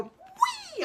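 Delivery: high squealing whee, like sliding down; a soul squeals as it zips back down; gleeful whee. Retold or imitated. Soul on a water slide, squealing a high whee. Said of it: "and they go".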